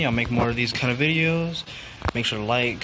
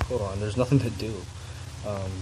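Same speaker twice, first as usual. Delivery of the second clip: ordinary talk, nothing marked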